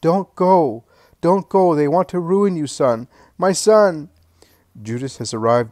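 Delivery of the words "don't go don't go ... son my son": desperate high loud cry; mother shouting after her son from the parapet; shouts. A narrator's reading. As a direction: call after him with everything. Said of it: "shouts to him".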